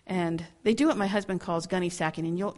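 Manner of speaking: ordinary talk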